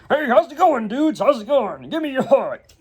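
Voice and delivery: high-pitched bro impression; hammed-up bro greeting; super bro. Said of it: "he's the super broy kinda guy".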